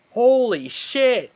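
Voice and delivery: high, loud, drawn-out exclamation; imitated amazed shout. Friend spotting a fire by the road, amazed shout. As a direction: stretch it out in mock astonishment.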